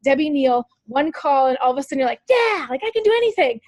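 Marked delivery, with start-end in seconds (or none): 2.3-3.6 high, bright burst of fist-pump energy; mock pep-rally cheer; pumped-up excitement. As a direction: sound suddenly fired up, like you can do anything. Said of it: "fist pump activity".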